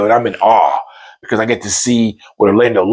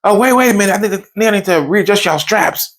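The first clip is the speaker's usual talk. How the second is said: ride operator; 9 semitones higher, faster than usual. Retold or imitated. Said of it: "He's like".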